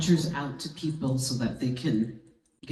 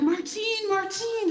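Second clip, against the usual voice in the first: high repeated call from a distance; ringing high call; calling out a name. Retold or imitated. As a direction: hail her twice across the terminal. Woman calling her across airport.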